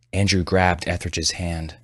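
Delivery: usual speaking voice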